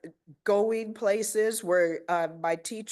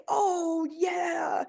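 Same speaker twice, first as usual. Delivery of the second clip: put-on much higher, shouted kid-like yeah; kids cheering; 12 semitones higher than usual. Principal imitating students answering her call, high kid shout. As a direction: play the little ones hollering back. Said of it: "And they would say".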